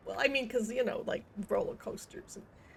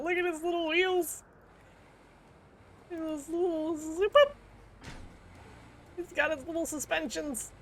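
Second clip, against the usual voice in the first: cutesy cooing; 7 semitones higher than usual. Real, not acted. Player admiring his little wheels, cutesy cooing. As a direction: coo over something tiny and cute.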